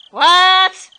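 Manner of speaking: loud startled shout. Acted. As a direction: jump at it. Startled exclamation.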